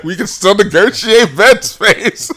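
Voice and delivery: high, slow, laughing through the offer; real laughter breaking up the words; words stretched high on a giggle. Real, not acted.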